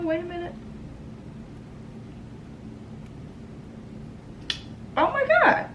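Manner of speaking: real choked-up gasp, pitch jumping high; catching herself as tears come; overcome. Real, not acted. Stopping herself mid-thanks to viewers, overcome. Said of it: "I'm actually getting emotional".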